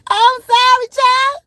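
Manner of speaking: amused apology; high loud laughing apology. Real, not acted.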